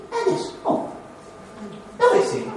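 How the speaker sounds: high-pitched mock alarm; high, acted bewilderment at someone missing; worried searching. Acting out finding someone gone, mock worried searching. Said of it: "siamo già immediatamente preoccupati".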